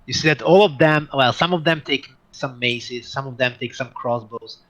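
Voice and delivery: usual speaking voice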